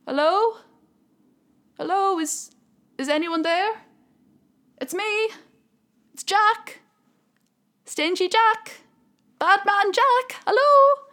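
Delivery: high, loud calls into the dark; calling out. Acted. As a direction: shout at the shut gates, hoping someone hears. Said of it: "He called out".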